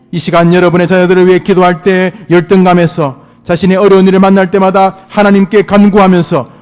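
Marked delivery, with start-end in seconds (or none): none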